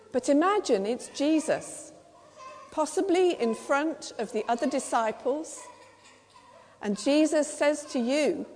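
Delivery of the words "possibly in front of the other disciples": ordinary delivery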